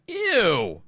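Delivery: high mock "Ew" of disgust; a grossed-out little "Ew". Reacting to a smug tweet with a high disgusted ew. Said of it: "you're just like".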